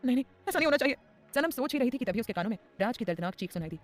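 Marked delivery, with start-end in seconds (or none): 0.0-1.0 put-on high, tearful girl's voice in panic; a scared girl fretting over the sword; frightened young woman. Sanam alone, fearing for Raj, high and panicked.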